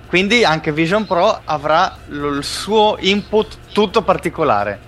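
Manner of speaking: rapt, running translation of a keynote; live keynote interpretation. Acted. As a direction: narrate the launch like you're swept away. Hosts call him in trance.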